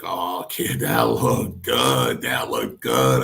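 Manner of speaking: slow, drawn-out imitation of a pleased old boss; mimicked delight, slow and drawling; really happy. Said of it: "he's really happy".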